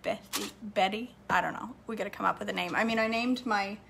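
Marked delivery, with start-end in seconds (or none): none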